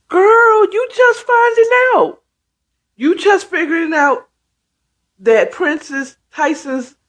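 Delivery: very high, incredulous teasing, acted out; mocking amusement. Mother-in-law to girl just finding out, gleefully mocking. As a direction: imitate someone tickled by another's slowness. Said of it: "she started laughing. She's like".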